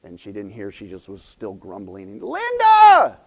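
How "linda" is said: high, very loud call to someone far behind; imitated yell. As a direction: yell the name out, acting the student. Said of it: "one of the students yelled".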